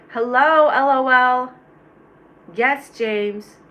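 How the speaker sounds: big, loud hello shouted out; loud greeting. Acted. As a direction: greet the chat at full volume. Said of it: "Why are you saying it like that?".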